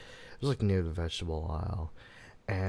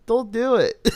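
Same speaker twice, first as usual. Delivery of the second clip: high, loud comic plea; hammed-up terrified cry between laughs; mock panic; 14 semitones higher, 9 dB louder than usual. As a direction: yelp it in exaggerated fright. Acted plea to imagined attacker, laughing.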